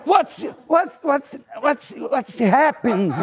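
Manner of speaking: very high stammering shock; he reacts to the patdown, very high and stammering; startled. Retold or imitated. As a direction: stammer in panic, hammed-up.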